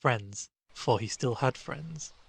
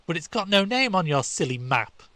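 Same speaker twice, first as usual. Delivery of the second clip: put-on scornful boy; 7 semitones higher, 6 dB louder than usual. A narrator's reading. Stanley voiced.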